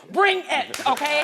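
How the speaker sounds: loud taunting challenge, the pitch jumping up; loud dare. Retold or imitated. Retelling how she dared her man's ex to come fight, loud taunt. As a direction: throw down the dare at full volume.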